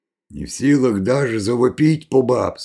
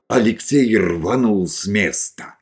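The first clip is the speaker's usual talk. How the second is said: ordinary delivery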